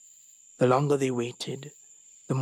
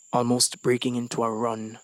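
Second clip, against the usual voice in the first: ordinary delivery, nothing marked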